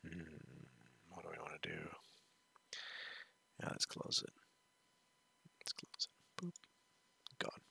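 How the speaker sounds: hushed whisper to himself. Real, not acted. Whispering while his son is nearby. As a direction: keep it a soft whisper, barely voiced.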